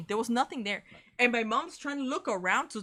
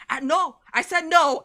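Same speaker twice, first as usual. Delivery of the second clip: loud, firm refusal; dad yelling; 6 semitones higher, 11 dB louder than usual. Retold or imitated. Dad loudly refusing the rude man's apology. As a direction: shut him down loudly. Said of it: "my dad starts yelling at the guy".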